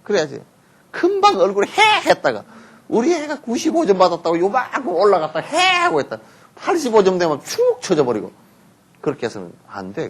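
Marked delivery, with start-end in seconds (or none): 1.7-2.1 high gleeful "heh" of a pleased mother; sudden very high pleased heh; delighted heh. Retold or imitated. Beaming face over good score. 5.4-5.6 delighted heh. Retold or imitated. Beaming face over good score.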